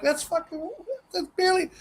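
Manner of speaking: high, hushed indignant grumble; muttered grumble. Retold or imitated. Guest grumbling after shutting the door, muttered indignation. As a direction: grumble under your breath in a squeaky voice.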